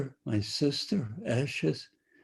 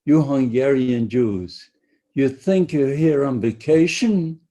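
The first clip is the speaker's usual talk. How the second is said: loud, higher, harsh mock-shouted threat; guard taunting arriving prisoners, loud and higher; retold guard's shout; 5 semitones higher, 5 dB louder than usual. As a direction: bark it at the new arrivals like a camp guard.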